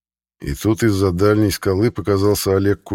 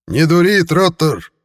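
much higher, louder shout; 11 semitones higher, 6 dB louder, slower than usual. A narrator's reading. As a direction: yell Троттер up at the gate. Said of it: "крикнул в ответ Курт". (громкий окрик: «Троттер!»)

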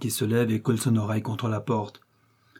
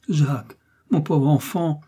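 slow, raised, anguished cry; despairing cry; 5 semitones higher, 4 dB louder than usual. A narrator's reading. Mother holding her son close, despairing cry. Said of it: "s'écrie-t-elle avec désespoir".

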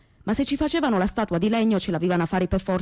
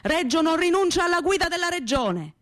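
mayor roaring at the crowd from the podium; mayor shouting; 8 semitones higher than usual. Acted. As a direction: proclaim it to the packed piazza. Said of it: "Il sindaco gridava".

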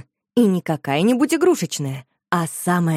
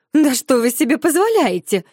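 louder offended demand; angry lady; 7 semitones higher, 5 dB louder than usual. A narrator's reading. The lady scolds the girls, loud and offended. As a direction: bristle with offended dignity. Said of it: "рассердилась тётя".

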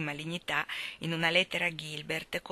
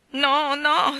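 sobbing; 8 semitones higher, 8 dB louder than usual. Acted. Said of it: "singhiozzò Dovi".

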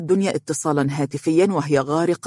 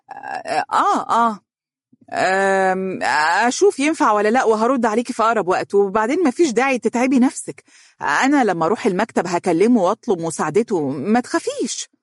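flustered false starts, then higher-pitched excuses; stammering, tense; 5 semitones higher than usual. A narrator's reading. Dunya puts off the worried mother, stammering nervously. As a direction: stall nervously, then reassure. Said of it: "وقالت بتلعثم".

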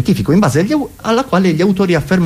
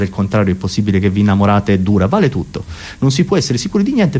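usual speaking voice

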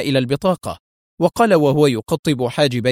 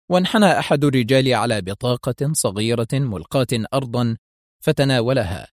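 plain reading voice

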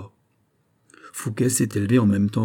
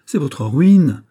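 loud, raised cry of alarm; outcry; 5 semitones higher, 8 dB louder, much slower than usual. Acted. Fouquet crying out over the coffer of gold, loud and raised. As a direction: burst out in dismay. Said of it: "s'écria Fouquet".

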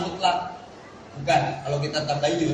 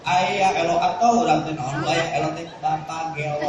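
ordinary talk, nothing marked

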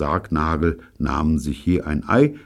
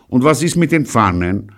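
polite; 9 semitones higher, 7 dB louder than usual. Acted. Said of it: "fragte Pirolo höflich".

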